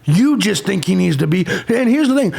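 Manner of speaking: ordinary talk, nothing marked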